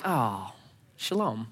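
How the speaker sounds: mock-serene, soft and low; serene calm. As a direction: sigh into blissful peace. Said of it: "if you start expressing anger, do you all of a sudden feel like".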